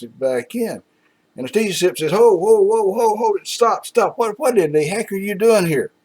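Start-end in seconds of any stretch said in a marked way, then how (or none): 2.1-5.9 high, loud panicked whoa; horrified buyer yelling stop; alarmed protest. Retold or imitated. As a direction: frantically stop him. T-Sip panicking, stopping Aggie.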